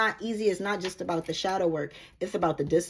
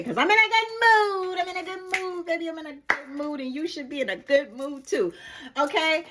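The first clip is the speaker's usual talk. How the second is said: much higher, sing-song delight; giddy; 7 semitones higher than usual. Real, not acted. Reader telling viewers she feels great, high giddy. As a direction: bubble over with joy. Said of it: "I done got too excited".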